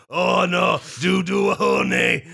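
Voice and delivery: high hammed-up yell; mock Pooh shout. Pooh banging his stomach into a tree, hammed-up high shout. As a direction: shout it like a honey-mad bear. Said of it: "as though to say".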